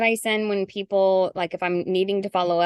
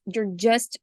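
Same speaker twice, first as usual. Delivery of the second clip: usual speaking voice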